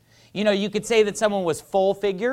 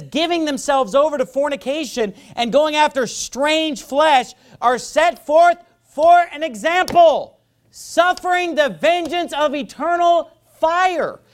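higher and louder, pounding out scripture; fiery preaching; 8 semitones higher, 4 dB louder than usual. Acted. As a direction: preach it to the back pews. Preaching scripture at full force.

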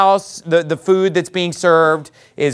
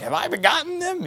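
high, soft mock protest; mock Moses outcry; 10 semitones higher, 9 dB quieter than usual. Preacher quotes what mom can't say like Moses, mock protest. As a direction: pitch it up quietly, a small imitation outcry. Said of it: "like Moses said".

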